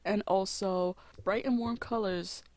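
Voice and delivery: ordinary delivery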